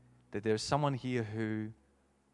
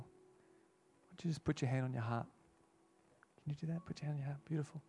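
much softer, gentle invitation; hushed pastoral prompt; soft altar call; 5 semitones higher, 6 dB quieter than usual. Real, not acted. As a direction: coax them quietly to respond. Quiet altar call with eyes closed.

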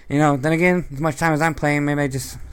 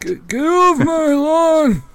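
very high, loud, stretched-out geriatric yell; old man voice; 7 dB louder, much slower than usual. Acted. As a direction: shout it slowly as a cranky old man. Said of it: "do an old man voice".

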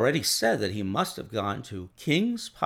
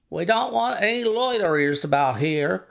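a higher, louder stable groom warning off; gruff groom; 8 semitones higher, 4 dB louder than usual. A narrator's reading. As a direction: shoo the strangers away, voiced as a rough groom. Groom warns off strangers.

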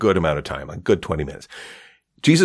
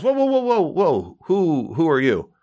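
high, drawn-out whoas to halt him; stopping someone; 9 semitones higher, slower than usual. Retold or imitated. St Peter halting the Pope at the door, high startled whoas. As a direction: rear up and stop the intruder.

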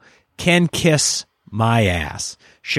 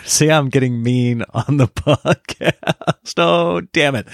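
chuckling through the words; amused self-aware aside; laughing at himself; slower than usual. Real, not acted. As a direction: laugh it off sheepishly. Laughs at his own meanness.

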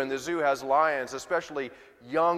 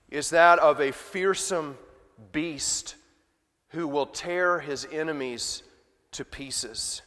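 ordinary talk

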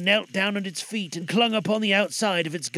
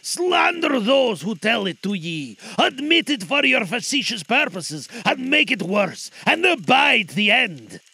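spirit crying out; 5 semitones higher, 6 dB louder than usual. Acted. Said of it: "cried the spirit".

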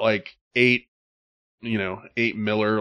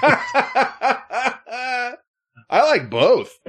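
laughing; 14 semitones higher, 3 dB louder, much slower than usual. Real, not acted. Laughing while answering.

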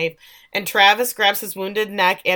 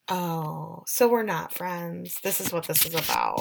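mock sad; 2 semitones lower, 6 dB quieter than usual. Misty realising they're not friends, mock sad. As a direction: sound let down, lightly hammed. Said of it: "makes the saddest face".

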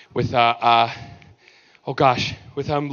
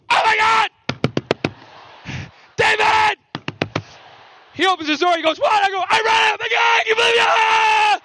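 hammed-up glee, much higher; mock ecstatic squealing. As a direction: burst out thrilled at a twist. [name] excited after reading script.

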